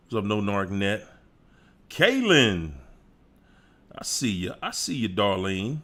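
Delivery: ordinary delivery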